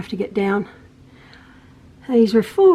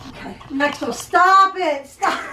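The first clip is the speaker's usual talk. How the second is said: scolding son; 6 semitones higher than usual. Real, not acted. Mom scolding son filming her.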